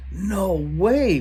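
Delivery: put-on boyish cry of disbelief, high; stunned "no way"; mild cry. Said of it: "one of the boys cried out".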